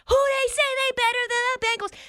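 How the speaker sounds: high, taunting fan voice; hyped Bengals fan. Retold or imitated.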